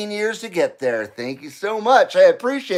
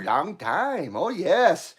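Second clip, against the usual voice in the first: ordinary delivery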